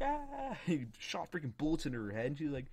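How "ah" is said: high-pitched mock scream; imitated scream. Mimicking the shot teacher, high mock scream. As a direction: cry out in mock agony.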